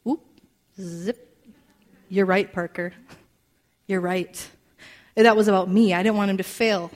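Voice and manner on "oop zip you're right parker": usual speaking voice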